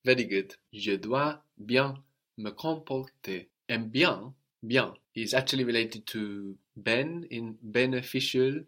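usual speaking voice

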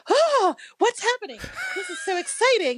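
thrilled squeal. Retold or imitated. Hearing a kick drum at soundcheck, a high thrilled squeal. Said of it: "I was like".

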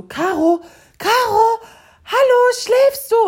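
high, loud aggro yelling. Retold or imitated. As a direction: imitate an irritated man yelling to wake someone. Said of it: "so richtig aggro".

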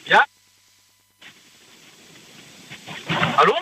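thin, distant phone-line sound; bluetooth headset mic. Real, not acted. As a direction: speak through a bad headset mic. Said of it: "ich hör dich schlecht".

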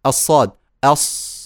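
أص with an emphatic sad; letter demo. Shown as a demonstration. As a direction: say أص, heavy and full. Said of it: "انطقوا وقولوا".

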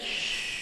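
long, soft shush; quoted hushing. Retold or imitated. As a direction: draw out a shh as if quoting it. Repeats the son's hush.

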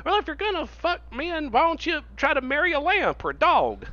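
high-pitched mocking objector; mock bigot voice. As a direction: parody the argument in a high, silly voice. Mocks people making a silly argument.

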